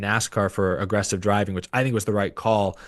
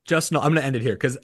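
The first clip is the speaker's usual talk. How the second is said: ordinary talk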